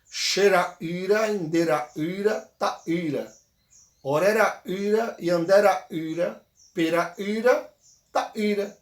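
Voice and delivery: each form drawn out slowly, syllable by syllable; slow repeat-after-me drill. Shown as a demonstration. Teacher to students, slow careful drill for repeating. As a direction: model every word slowly for the class to echo. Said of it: "Vamos repetir comigo?".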